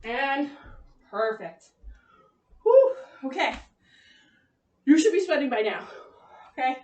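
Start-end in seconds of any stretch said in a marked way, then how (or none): 2.6-3.0 loud high exhaled "whew"; winded whew. Real, not acted. Coach finishing burpees with the class, winded whew. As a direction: blow out a high, relieved whew after exertion.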